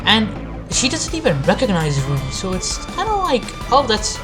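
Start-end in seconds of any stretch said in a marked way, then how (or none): none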